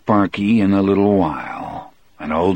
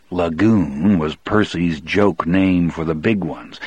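ordinary delivery, nothing marked

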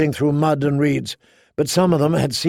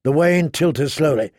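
ordinary delivery

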